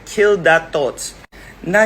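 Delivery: usual speaking voice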